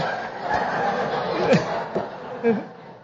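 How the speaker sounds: airy, wheezing laugh with almost no voice; breathy silent laugh. Real, not acted. Preacher laughing at his own joke about fumbling for the book, wheezing. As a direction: let a soundless laugh shake out of you.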